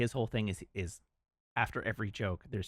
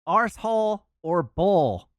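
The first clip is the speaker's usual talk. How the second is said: loud, slow mock Swedish accent, higher; misheard Swedish accent; 7 semitones higher, 10 dB louder than usual. Re-telling the punchline to chat, loud slow mock Swedish accent. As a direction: stretch arsehole out like a heavy Swedish accent. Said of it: "aerosol was misunderstood as, as arsehole".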